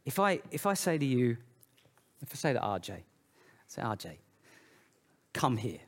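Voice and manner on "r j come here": low, soft stern summons, a hint of threat; frowning stern call. Shown as a demonstration. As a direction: call him over like a frowning father. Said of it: "I'm frowning".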